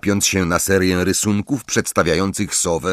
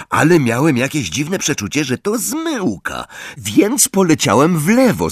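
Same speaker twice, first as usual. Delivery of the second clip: put-on higher, eager voice of a proud teenage keeper; Ron boasting; 6 semitones higher than usual. Ron retelling his save to friends, boastful and eager. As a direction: relive the save with swagger and growing excitement.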